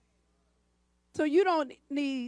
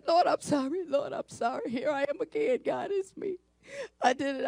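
softly acted tearful confession; hushed, mock-weepy repentance; imitated crying prayer; 4 dB quieter than usual. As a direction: plead quietly, as if broken before God. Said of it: "I'm crying".

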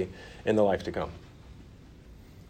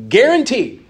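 guarantee rings out high and loud; emphatic guarantee; 10 semitones higher, 6 dB louder than usual. Real, not acted. Preacher telling believers their destination, guarantee loud and high. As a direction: land hard on guarantee as the promise.

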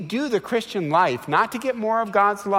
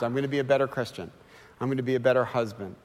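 1 rushed hollow promise; 4 semitones lower, 4 dB quieter, much faster than usual. Retold or imitated. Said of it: "You made that promise ten million times".

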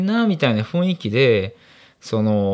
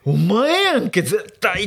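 mock accusing yell; 9 semitones higher, much slower than usual. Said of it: "クソほどでかい声で".

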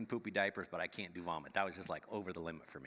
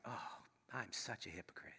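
hushed inner whisper of shame; whispered to himself; 8 dB quieter than usual. Retold or imitated. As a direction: breathe it out like a private confession. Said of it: "And I'm just like".

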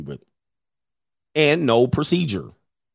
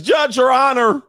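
high, loud mocking wail of Your Honor; shrill mock plea, loud; whiny plea to the judge; 12 semitones higher, 5 dB louder than usual. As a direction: mimic a crybaby begging the judge. Lawyer crying to the judge.

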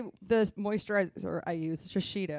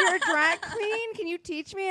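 gushing fan voice; 11 semitones higher than usual. Retold or imitated. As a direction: gush like an excited fan. Quoting fans who want makeup.

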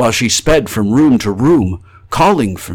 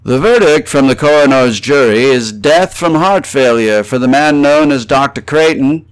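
melancholy official intonation; 5 semitones higher, 6 dB louder than usual. A narrator's reading. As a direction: intone it like a solemn coroner's announcement. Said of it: "melancholy intonation of the professional man".

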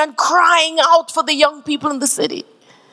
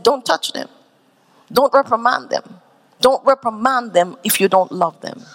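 firm tender instruction; 8 semitones lower, 3 dB quieter than usual. Retold or imitated. Pastor instructs ushers, retold.

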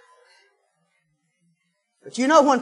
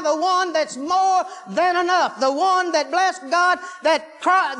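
rising sermon cadence, pitch lifted; preaching at full force; 5 semitones higher than usual. Real, not acted. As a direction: preach it, building the fire. Preacher builds to full fervour.

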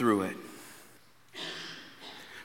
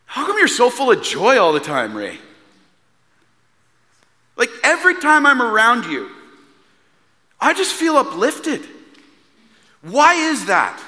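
amazed neighbor; 11 semitones higher, 6 dB louder than usual. Retold or imitated. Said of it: "He goes".

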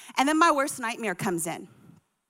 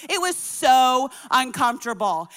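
ordinary talk, nothing marked

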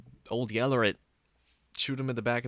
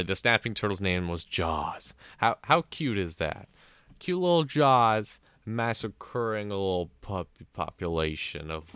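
ordinary delivery

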